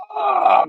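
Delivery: high cartoon grunt of pain; Goblin's hit groan. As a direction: cry out high as if struck. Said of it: "He's like".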